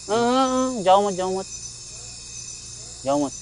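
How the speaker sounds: urgent, high call to stop his friend leaving; sudden alarm, voice jumping up. Real, not acted. Startled by a noise, calling to his brother: alarmed.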